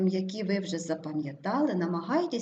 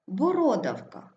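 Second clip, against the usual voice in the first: Бородавка stressed as бо-РО-давка; stress on second О. Shown as a demonstration. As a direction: punch РО in Бородавка. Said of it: "Наголос падає на друге О". (Бородавка з наголосом на РО)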